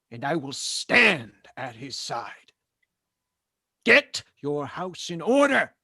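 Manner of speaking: a lieutenant preaching loudly to his platoon about duty; fiery preaching. Acted. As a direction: preach it harder each time, like a drill sergeant's sermon.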